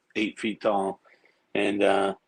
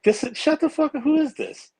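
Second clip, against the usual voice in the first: much higher, louder, in retold disbelief; incredulous outburst; 4 dB louder than usual. On a call he thinks is a prank, an incredulous higher yelp. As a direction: retell snapping back at a suspected prank caller. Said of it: "And I'm like".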